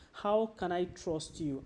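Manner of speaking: ordinary talk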